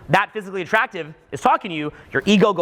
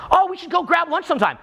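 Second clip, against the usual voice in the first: breezy fake invite; 8 semitones higher than usual.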